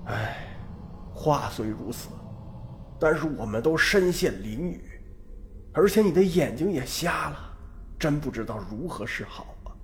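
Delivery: weary sigh, then hopeless murmur. A narrator's reading. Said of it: "老汉叹了口气".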